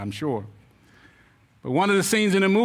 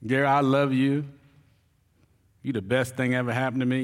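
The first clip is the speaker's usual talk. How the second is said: mock tender sweet talk; 5 semitones lower than usual. Husband sweet-talks wife despite anger.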